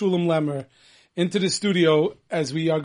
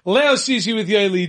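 higher and louder, laughing into the song intro; excited announcer intro; 5 semitones higher, 6 dB louder than usual. Acted. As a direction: hype up the debut like a radio host.

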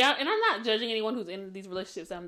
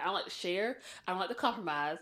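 ordinary talk, nothing marked

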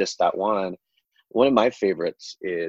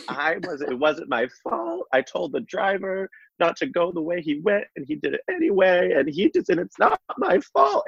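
high, whiny retold breakdown, rising to a squeal at the end; hammed-up tearful protest; mock meltdown; 9 semitones higher than usual. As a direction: imitate a teen pleading it wasn't her fault, climbing higher. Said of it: "the best meltdown".

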